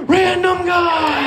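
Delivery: high, slow, stretched-out alarm; alarmed realization. Retold or imitated. Realizing the caller is the random guy, high slow alarm. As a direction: drag it out like a horror reveal. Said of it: "And then I was like".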